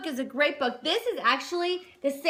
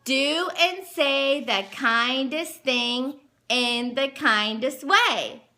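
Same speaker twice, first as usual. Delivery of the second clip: slow, deliberate read-aloud; each word given room, like a lesson; much slower than usual. Shown as a demonstration. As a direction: spell it out slowly for a small child. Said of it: "And then it says".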